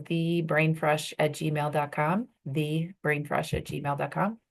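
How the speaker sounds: slow, spelled-out "the brain fresh at gmail dot com"; dictating. Real, not acted. Coach giving students her email address, slow and careful. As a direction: give the address slowly so they can write it. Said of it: "dictating email address".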